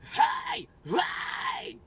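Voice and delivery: very high, loud screams; high pitch scream. Shown as a demonstration. Demoing his high pitch screams. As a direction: hit the top of your range. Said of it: "That's my high pitch screams".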